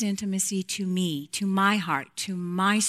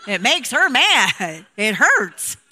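louder and slow, every word leaned on; firm scolding; 3 semitones higher, 10 dB louder, slower than usual. Retold or imitated. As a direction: set the husband straight, slowly. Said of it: "I go".

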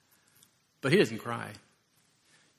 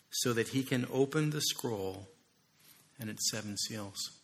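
low, slowing elder's comfort; solemn reading; 3 semitones lower, slower than usual. Acted. Elder comforting weeping John, low and solemn. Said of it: "one of the elders said to me".

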